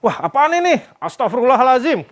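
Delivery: high-pitched mock alarm; imitating panicked wife. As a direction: act out a startled wife. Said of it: "Panik kita".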